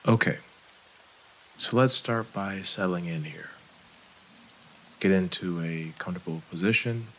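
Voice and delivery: calm, unhurried meditation voice with long pauses; soothing breathing-exercise guide; guided meditation. Real, not acted. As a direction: ease them through each breath, leaving space after every phrase.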